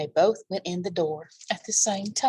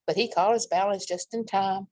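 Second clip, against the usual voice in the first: plain reading voice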